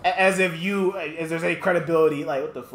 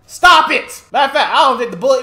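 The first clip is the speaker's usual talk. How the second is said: angry yell; 8 semitones higher, 9 dB louder than usual. Real, not acted. Streamer yelling at Megan's tweet, furious. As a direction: shout it down in outrage.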